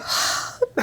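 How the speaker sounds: imitated old man's sigh; big breathy sigh; acted sigh. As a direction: heave a mock sigh. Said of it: "And then you sigh".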